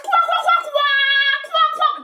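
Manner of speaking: high, sustained string of quacks; mock bird quacking. Vlogger mocking someone as a bird, high quacking.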